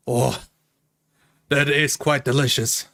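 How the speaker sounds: usual speaking voice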